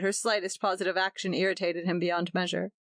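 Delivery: usual speaking voice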